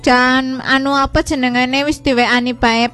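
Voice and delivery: usual speaking voice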